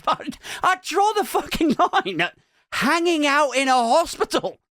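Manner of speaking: high, exasperated comic rant; mock indignation. Father of two ranting about casualty, indignant. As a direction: pitch the protest high and incredulous.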